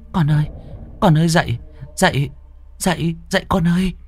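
high, pleading calls to wake someone; urgent calling. Acted. Mother shaking her limp baby to wake him, urgent calling. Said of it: "lay gọi".

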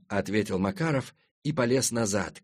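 usual speaking voice

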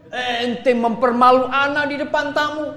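husband scolding his wife before the guests, loud; angry husband. Retold or imitated. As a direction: snap at the wife in front of guests. Today's husband scolds jealous wife.

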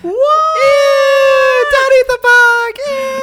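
put-on high, loud squealing kid voice; grossed-out student. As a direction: shriek like a disgusted teenager. Kids at assembly react.

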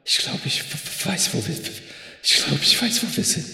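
stammering through chattering teeth; chattering stutter. Acted. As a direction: stutter from the cold, shivering. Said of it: "so kalt".

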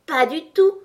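high, loud dismissive retort; contemptuous retort. A narrator's reading. Tweedledee contradicting Alice, contemptuous. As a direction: cut her off with mild scorn. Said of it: "répondit Blanc-Bonnet d'un ton méprisant".

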